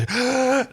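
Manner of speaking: a sharp high mock gasp of excitement. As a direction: gasp like a fan hooked on the plot.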